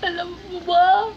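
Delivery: lightly acted dramatic plea; a hint of a soap-opera actress calling out; mock dramatic actress. Practicing acting lines for viewers, a touch theatrical. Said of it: "pangarap ko maging artista".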